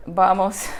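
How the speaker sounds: usual speaking voice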